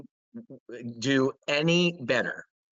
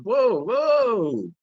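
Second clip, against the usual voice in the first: loud, higher startled whoa; mock shock; 9 semitones higher, 5 dB louder than usual. Reacting to the media ignoring the news, in loud mock alarm. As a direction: act stunned by the silence. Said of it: "I'm like".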